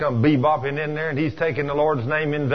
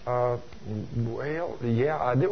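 soft, low, halting mumble of excuse; stall, searching for an answer; sheepish hedging; 3 semitones lower, 6 dB quieter, much slower than usual. Acted. Caught out by God's question.

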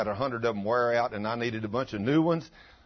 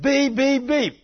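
mock car horn; 13 semitones higher, 7 dB louder, much slower than usual. Honking again at the car.

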